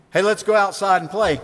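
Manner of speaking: lightly acted kid's invitation; child inviting playmates. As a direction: call friends out to play. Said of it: "this idea of mocking".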